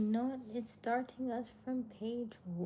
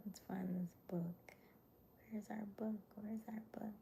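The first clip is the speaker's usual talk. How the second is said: soft unvoiced whisper; 8 dB quieter than usual. Real, not acted. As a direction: whisper it close to the mic. Whispers throughout stream.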